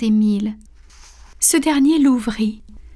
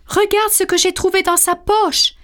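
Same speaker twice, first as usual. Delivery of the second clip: higher, eager exclamation of discovery; cry out; 8 semitones higher than usual. A narrator's reading. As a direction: call out the find, pitched up. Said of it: "s'écria un deuxième policier". (s'écrie, voix plus aiguë)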